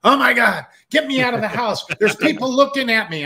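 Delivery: high, loud, frantic plea to escape; a man in a paranoid panic begging to get out, frantic loud yelling; panicked yell. Retold or imitated. Retelling a panic attack after smoking.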